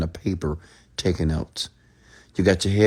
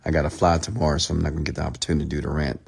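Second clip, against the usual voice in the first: ordinary delivery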